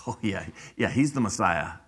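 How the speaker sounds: low, soft mock agreement; sarcastic. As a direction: pretend to agree, with understated sarcasm.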